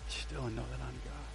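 hushed repeat. Shown as a demonstration. Pastor repeats the verse softly to a silent church, hushed. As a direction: let the verse fall to a hush.

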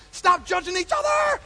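slow, high, full-voiced rebuke; exhorting shout. Real, not acted. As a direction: shout it out to the whole room. Preacher rebukes congregation's quarrels.

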